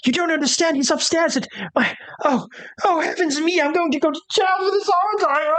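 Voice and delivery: high, panicked, tearful servant voice; a terrified valet babbling through tears; sobbing valet. Acted. Valet weeps to the intruders in panic, high and tearful. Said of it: "he kinda just collapses and he's crying".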